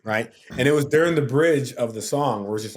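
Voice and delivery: usual speaking voice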